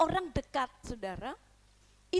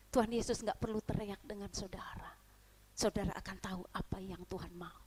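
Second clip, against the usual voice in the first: softer, hushed preaching; whisper; 6 semitones lower, 6 dB quieter than usual. Real, not acted. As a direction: pull them close in a hush. (khotbah berbisik)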